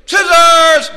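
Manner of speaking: high, very loud shout; defiant yell. Acted. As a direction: burst up from the water yelling it. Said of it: "she yells out".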